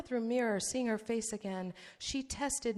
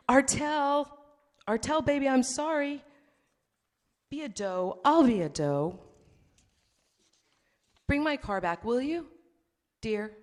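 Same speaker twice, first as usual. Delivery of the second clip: swings from whiny pleading to loud cursing rage; a jilted jerk begging then lashing out, louder; needy, angry ex-boyfriend; 5 dB louder than usual. Acted. Seth's texts voiced aloud.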